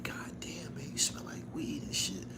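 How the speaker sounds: retold words to a friend, whispered under the breath; hushed. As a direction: whisper it to the homie at the funeral.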